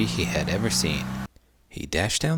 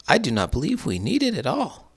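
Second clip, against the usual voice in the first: lightly voiced wife; 10 semitones higher, 4 dB louder than usual. A narrator's reading. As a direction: gently refuse the gift.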